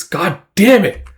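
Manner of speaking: acted exasperated outburst; frustrated curse. Guts curses his luck.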